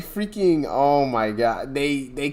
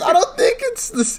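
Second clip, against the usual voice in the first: laughing. Real, not acted. Laughing while talking.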